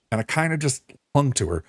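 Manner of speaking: ordinary talk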